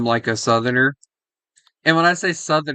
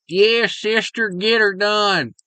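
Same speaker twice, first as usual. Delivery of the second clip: higher, slow Southern Alabama twang; Alabama accent; 4 semitones higher than usual. Retold or imitated. Mocking how Alabama people talk, Southern Alabama accent. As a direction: drawl it like a cheery Alabama local. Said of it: "Alabama's like".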